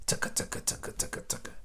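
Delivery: soft unvoiced tucka tucka chop; helicopter noise. Dad making helicopter blades for his child. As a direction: whisper the rotor sound. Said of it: "and a helicopter".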